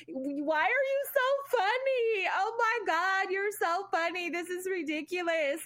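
high, laughing, tearful disbelief; shocked happy squeal. Retold or imitated. Girlfriend reacting to boyfriend's surprise video, squealing with joy. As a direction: squeal it through happy tears. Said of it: "But I was just, like, so shocked".

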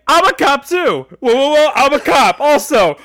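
loud, high cop announcement; hammed-up shout to halt a brawl; mock urgent shout. Acting out more surprise cops.

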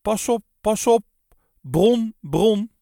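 high, loud warning shout; alarm cry. A narrator's reading. Warning the boys from the corridor, alarm cry. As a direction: cry out the alarm over the din. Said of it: "als een alarmkreet boven het orkest uit".

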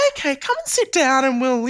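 imitated mum, pitched well up; high-pitched mum voice. Mum inviting her child to sit and talk, in a high imitated voice. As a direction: keep mum's high voice going as she replies. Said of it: "the highest pitched voice".

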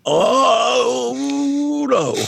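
high, dismayed Oh no; mock horror, a sudden high cry; shocked oh no. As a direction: yelp out a horrified oh no. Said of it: "And I went".

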